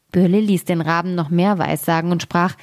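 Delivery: ordinary delivery, nothing marked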